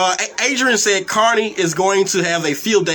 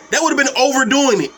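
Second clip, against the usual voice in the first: ordinary delivery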